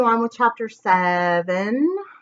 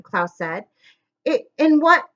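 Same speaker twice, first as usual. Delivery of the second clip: ordinary delivery, nothing marked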